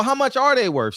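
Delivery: ordinary talk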